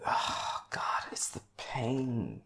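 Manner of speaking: slow, whispered ache; pained whisper. Real, not acted. Film fan moved by a character's tragedy, pained whisper. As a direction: sigh it out slowly in a near-whisper. Anguish over the character's pain.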